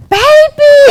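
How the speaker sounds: high, loud delighted shriek; mock mother-in-law's excited scream; imitated scream. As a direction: scream it out in greeting. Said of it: "like, screaming. She's like".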